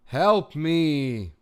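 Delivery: loud, high plea; desperate call for help; exasperated cry for help. Real, not acted. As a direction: throw the plea out to the room. Stuck on Wordle, calling for help.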